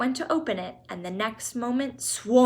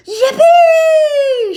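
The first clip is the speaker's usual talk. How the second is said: very high, loud whoop of joy; joyful shout; 19 dB louder than usual. Acted. Grandpa Joe told he may go, very high loud whoop. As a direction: explode with delight. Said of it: "shouted Grandpa Joe".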